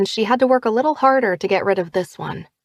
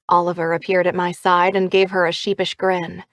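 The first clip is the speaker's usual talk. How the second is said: ordinary delivery, nothing marked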